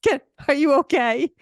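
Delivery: imitating the startled teenage boy. Mimicking the teen boy asking if she's hurt after her fall. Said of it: "who's just looking at me".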